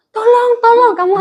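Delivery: high, laughing fake cry of tolong; mock the trapped bully through laughter; jeering at the villain caught in the door; mock cry for help.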